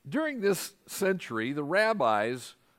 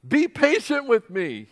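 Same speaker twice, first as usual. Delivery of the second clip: higher, louder frantic plea; desperate plea; 8 semitones higher, 7 dB louder than usual. A narrator's reading. Servant pleading with the king for time, frantic and high. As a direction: beg for time, voice straining up. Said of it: "he said".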